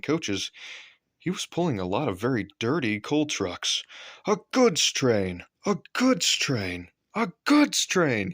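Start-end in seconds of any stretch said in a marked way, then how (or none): 4.3-8.3 slow, high, sulky complaint; grumbling. A narrator's reading. Gordon grumbling about pulling coal trucks, slow and sulky. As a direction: drag it out in disgust. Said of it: "he grumbled".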